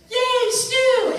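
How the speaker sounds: put-on high, loud childlike cheer; imitating young Al. Retelling the recording session, high child cheer. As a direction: squeal it like a delighted little boy. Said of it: "So Al turns around and goes".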